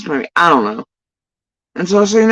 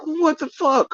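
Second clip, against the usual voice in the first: mock outrage; 11 semitones higher, 5 dB quieter, slower than usual. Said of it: "And I'm like".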